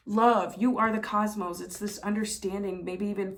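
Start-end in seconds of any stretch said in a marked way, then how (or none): none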